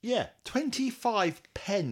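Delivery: high, slow, stretched incredulity; mock amazement. Host confirming the weekly price, mock amazed. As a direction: drag out the bargain price in wonder.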